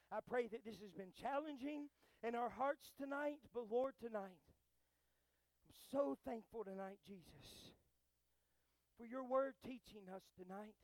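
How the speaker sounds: earnest, phrase-by-phrase prayer with long pauses; measured opening growing into fervent prayer; public prayer. Real, not acted. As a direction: pray aloud to God for the congregation. Leads the congregation in prayer.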